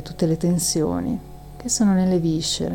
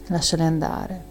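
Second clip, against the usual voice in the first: usual speaking voice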